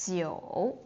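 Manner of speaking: jiu low and dipping. Shown as a demonstration. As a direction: keep jiu down in a low dip. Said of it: "jiu is low again".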